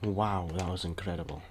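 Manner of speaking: sarcastic praise. Acted. Builder mocking his own ride, fake awe. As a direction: fake amazement, dry and hushed. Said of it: "Just kidding, it was crap".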